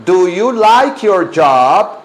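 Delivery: very slow, drawn-out question for the class; deliberate word-by-word drill; slow modelling. Shown as a demonstration. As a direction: stretch each word for learners to copy.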